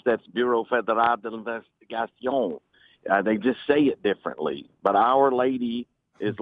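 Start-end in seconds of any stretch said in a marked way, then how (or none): 0.3-2.6 Bureau Fédéral d'Investigation said the French way; French accent on an agency name; French pronunciation. Shown as a demonstration. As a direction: read it like a French speaker. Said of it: "In French".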